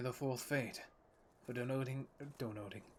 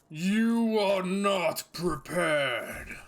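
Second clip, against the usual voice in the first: slow, huge, high booming roar; Illidan voice; 6 semitones higher, 13 dB louder, much slower than usual. Acted. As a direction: roar each word like a demon lord. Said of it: "I'll give it another go".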